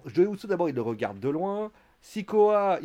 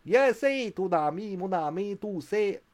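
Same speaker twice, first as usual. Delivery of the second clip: higher, mock Québécois accent; 5 semitones higher, slower than usual. Sami Zayn answering about his friend, in a bad Québécois accent. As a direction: put on a rotten Québécois accent. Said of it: "j'ai fait l'accent québécois le plus pourri".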